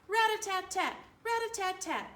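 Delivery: high, loud marching rat-a-tat; drumbeat chant. A narrator's reading. Narrator drumming the parade march, high and punchy. Marching drum sound effect.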